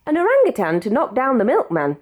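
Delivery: ordinary delivery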